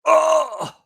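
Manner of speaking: loud, high moan of pain; acted-out moan. Wounded man is picked up under the shoulders, moaning. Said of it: "the man moans".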